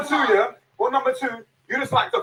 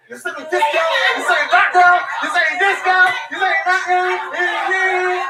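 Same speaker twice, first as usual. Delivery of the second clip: loud, high-pitched hammering repetition; heated shouting; 8 semitones higher, 5 dB louder, slower than usual. Real, not acted. Man arguing with women over insults, heated shout. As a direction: shout it out to win the argument.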